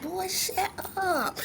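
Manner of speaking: high, soft, slow mock protest; bashful brush-off. Wife to praising husband, high bashful brush-off. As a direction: wave off the compliment in a tiny high voice.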